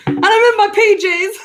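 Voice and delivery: high, loud burst through laughter; real laughter breaking into the words; laughing admission. Real, not acted. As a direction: confess it while cracking up. Laughing confession about wearing pajamas.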